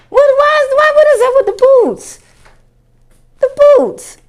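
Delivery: high incredulous whine. Real, not acted. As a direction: squeal it in disbelief. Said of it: "outraged at boots".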